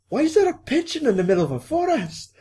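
very high-pitched acted disbelief; mock bewildered wail. Podcaster voicing bafflement at the pigeon, high mock wail. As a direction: squeal the question, baffled.

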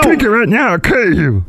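high character voice, suddenly menacing; brewmaster turned threatening. Acted. Brewmaster forcing root beer on Mike, high-pitched threat. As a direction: falsetto order turning into a threat. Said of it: "Wow, that's a change".

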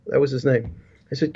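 ordinary delivery

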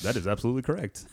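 quick, hushed stooge reply; audience plant voice. Retold or imitated. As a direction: rattle it off like a planted audience member. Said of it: "the plant in the audience".

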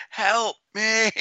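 squeaky pleading voice; high mock plea. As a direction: beg for help in a thin high voice. Higher repeat of the plea.

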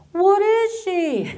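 high, slow, wondering question with a laugh; puzzled cook. Retold or imitated. Cooks wondering about her origin, high and slow. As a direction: drag it out, amazed.

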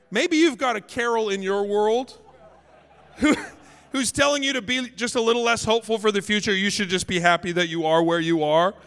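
usual speaking voice

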